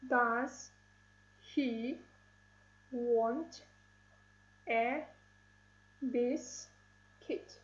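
"Does he want a biscuit?" split into single words, a pause after each. Shown as a demonstration. Teacher counts syllables for pupils, words separated by pauses. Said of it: "Six syllables".

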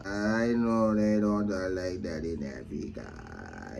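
very slow, deep monotone repeat; low drawn-out drone. Shown as a demonstration. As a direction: stretch every word out, low and soft. Repeats the line in exaggerated slow drone.

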